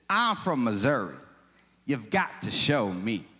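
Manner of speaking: slow, lightly put-on heavy Missouri drawl; a hint of an old Missouri representative's accent. Preacher imitating an 1899 Missouri congressman, heavy Missouri accent. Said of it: "I'm gonna say how I think he said it. He probably had, like, this heavy just accent".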